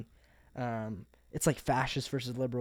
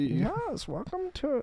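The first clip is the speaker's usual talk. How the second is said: much higher hammed-up German accent; high mock German accent; 13 semitones higher, 4 dB quieter, much slower than usual. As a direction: play a goofy German host. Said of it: "German accent".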